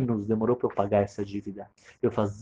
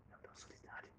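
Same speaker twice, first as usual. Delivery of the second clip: sussurro sem voz, quase inaudível; whisper; 33 dB quieter, faster than usual. Acted. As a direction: segreda a revelação como um segredo. Joalheiro revela segredo à esposa.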